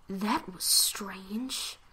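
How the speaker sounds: slow whispered thought. Acted. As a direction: breathe it uneasily. Private thought in dark bakery.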